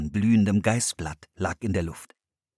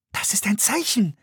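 higher, eager exclamation; excited call; 12 semitones higher than usual. A narrator's reading. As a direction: sure it means something. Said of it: "rief Anne". (aufgeregter Ausruf)

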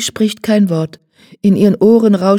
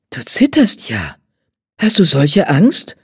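puzzled; 2 semitones lower than usual. A narrator's reading. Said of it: "fragt Jan verwundert".

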